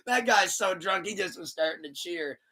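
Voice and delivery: ordinary delivery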